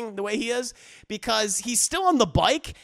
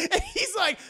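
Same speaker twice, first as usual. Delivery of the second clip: ordinary delivery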